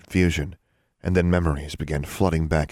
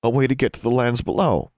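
high, louder accusation; accusing; 7 semitones higher, 5 dB louder than usual. Acted. Daughter pressing her father, accusing. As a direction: press the charge against him.

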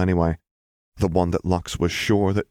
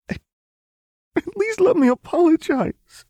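put-on much higher, louder pleading young woman; 5 dB louder than usual. A narrator's reading. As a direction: beg for the chance to apologize. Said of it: "Lux pleaded".

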